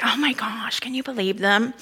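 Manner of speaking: hushed, breathless mock disbelief; scandalized whisper. Preacher voicing the congregation's judgment, whispered. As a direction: whisper it like gossip about the Pharisees. We look at them and say.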